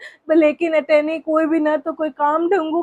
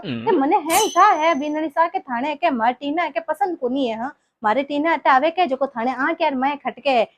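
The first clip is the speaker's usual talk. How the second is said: ordinary delivery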